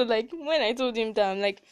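ordinary delivery

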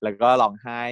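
ordinary talk, nothing marked

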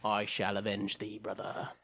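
low, quiet theatrical vow; mock solemn avenger. Shaw vowing revenge for his brother, mock-solemn and low. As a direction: pledge it low, like a grand film villain.

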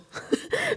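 brief high, soft laugh; a quick real giggle, pitched high; short laugh. Real, not acted. Host amused by her own term for hair transplants, short laugh. Amused after saying "die Haube.

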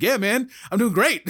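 high, loud, eager burst; excited Labrador voice; excitable imitation. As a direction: bounce it out like an excited puppy. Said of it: "but in yours, like".

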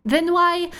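put-on a toddler pressing for the reason; little daughter. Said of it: "she'll go".

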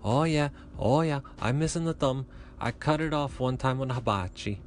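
high mock Japanese accent drifting Italian; cheerful chef in a badly faked Japanese accent. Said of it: "Don't ask me to do accents".

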